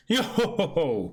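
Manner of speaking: a light, hammed-up yo-ho-ho laugh; lightly voiced skeleton chuckling; skeleton's laugh. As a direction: toss off a cheery bony cackle. S0 voicing Brook's signature laugh.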